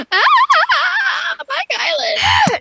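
very high, loud delighted shriek; thrilled squeal. Real, not acted. Girl spots her friend in the game, delighted shriek. Excited gasp spotting a friend.

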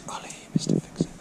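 hushed aside to the camera; whisper. Real, not acted. Vlogger whispers Ollie's nickname to the camera, whispered. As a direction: breathe the nickname softly. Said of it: "whispered aside to camera".

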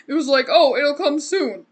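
loud laugh spilling into the quote. Real, not acted. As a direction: crack up while quoting. Laughing at quick delivery.

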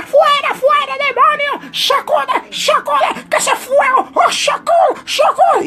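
very high, loud shrieking church lady; mock church lady shouting at the sleeping boy; old church lady. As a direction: chant it like a frenzied old sister. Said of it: "empezó una doñita".

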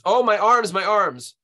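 loud, high cry of pain; narrated scream. A narrator's reading. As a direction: cry out as if being tortured. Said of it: "and then now she screams".

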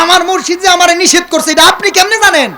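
high, fast, shouted question; loud challenge. Acted. Same question repeated, roused crowd. (চড়া গলায় দ্রুত প্রশ্ন)

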